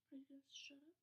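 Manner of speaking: hushed, breathy aside; whispered retort. Retold or imitated. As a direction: mutter it under your breath, irritated. Retorting to an annoying stranger.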